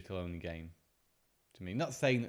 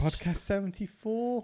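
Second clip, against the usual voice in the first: David [name] voice; 8 semitones higher, 3 dB louder than usual. Pretend. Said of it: "I'm the better [name] impersonator".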